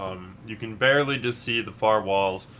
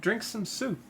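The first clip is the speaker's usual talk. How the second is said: higher, sly forest witch; creepy dryad hostess; 7 semitones higher than usual. Acted. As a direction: coax them like a witch. Dryad invites to eat.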